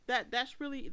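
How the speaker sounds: ordinary talk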